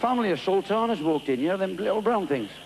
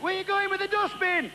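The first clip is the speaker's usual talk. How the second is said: shouted question. Acted. Bloke shouting at Lone Ranger.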